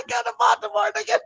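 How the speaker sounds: high laughing yell; laughing shout. Real, not acted. Laughing shout at his own joke.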